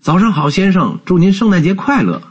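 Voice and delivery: high-pitched, friendly hail; cheerful greeting. A narrator's reading. Kind passers-by hail Scrooge on the street, cheerful greeting. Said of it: "主动跟他打招呼".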